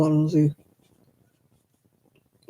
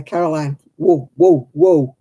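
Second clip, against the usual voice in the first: mock halting call, louder on the whoas; retold interruption; 5 dB louder than usual. Telling her fast-talking Irish friend to stop, retold halting call. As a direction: rein in a fast talker with firm, separate whoas.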